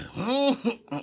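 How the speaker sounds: high, loud gleeful creature cackle. Acted. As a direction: cackle with sly delight. Gollum cackles.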